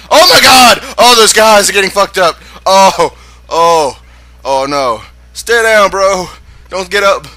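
real excited shouting at a fight; excited yelling. Real, not acted. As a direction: yell at the screen as the fight breaks. Reacting to a fight.

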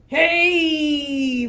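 booming troll shout; troll's shout. Acted. Troll yelling at a tiny goat, loud shout. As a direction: bellow it like a giant troll.

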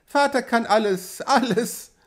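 put-on high, childlike proud voice; a little girl, pitched high and pleased; delighted small child. A narrator's reading. Child clapping for her father, high happy voice.

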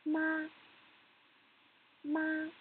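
"Ma" held high and level; high, flat tone. Shown as a demonstration. Teacher demonstrates the first tone, "Ma" high and flat. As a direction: say "Ma" on one high, steady note. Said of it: "Let's do it again".

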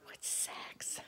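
hushed, innocent little question; naive whisper. Retold or imitated. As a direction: whisper it like a clueless kid. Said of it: "I'm like".

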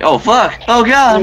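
high, loud cries of alarm; panicked yelling. Real, not acted. Player surprised mid-distraction in game, high panicked yelling. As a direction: yell out in sudden panic.

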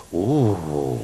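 low, soft drawn-out ooh; awed ooh. Retold or imitated. Malamute owners watching a dog sit, imitated awed ooh. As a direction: imitate a hushed crowd's long ooh. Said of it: "every single Malamute owner goes".

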